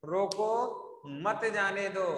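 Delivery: "रोको" on a rise, pause, then "मत जाने दो"; dictation-like split before "मत जाने दो"; pause after रोको. Shown as a demonstration. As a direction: break the phrase right after "रोको". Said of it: "क्या लिखा मैंने?".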